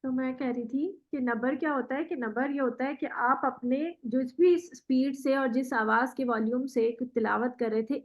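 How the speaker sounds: normal talking voice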